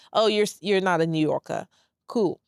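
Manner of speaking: ordinary delivery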